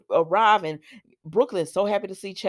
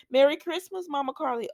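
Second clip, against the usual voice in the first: put-on high, sweet little-girl voice; cute little girl; 7 semitones higher than usual. Little girl greeting Carly at the door, cute. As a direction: pipe it up like a small child. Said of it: "this is the cutest little girl".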